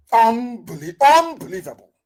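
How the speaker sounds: high, loud, broken restart; outraged disbelief. Real, not acted. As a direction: burst out, appalled. Disbelief repeated louder, second take.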